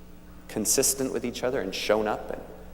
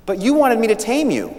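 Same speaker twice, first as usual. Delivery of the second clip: put-on much higher and louder childish objection; protesting little prince; 12 semitones higher, 10 dB louder than usual. Little prince protesting to the crying fox, high and loud.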